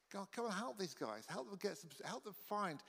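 softly spoken short prayer; quiet petition to God. Acted. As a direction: pray over them gently. Brief prayer for the congregation.

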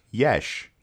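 "Yesh" in a lisping cartoon cat's way; cat's speech impediment. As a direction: say yes as "Yesh", with a mushy SH. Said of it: "it makes an S and H sound when it's trying to say yes".

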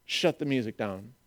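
low, very soft order; hushed command. Acted. Jesus halting worship over the missing people, hushed and low. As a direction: drop right down to stop everything.

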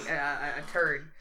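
ordinary delivery

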